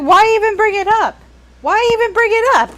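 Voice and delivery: high, loud, exasperated repeat. Retold or imitated. Reader complaining to friends about the book, high and exasperated. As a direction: throw up your hands at a pointless question. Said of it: "I was like".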